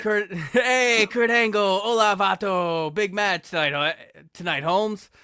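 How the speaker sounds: put-on joking Chicano accent to a rival backstage. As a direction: play Eddie with a cocky Chicano accent, slowed on hola vato. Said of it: "I gotta do an accent".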